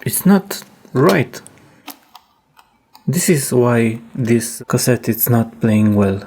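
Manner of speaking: ordinary delivery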